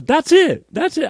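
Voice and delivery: very high, excited quote; high-pitched retold eureka, loud start; excited recognition. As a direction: exclaim it as a buddy spotting it. Retold friend recognizing the can.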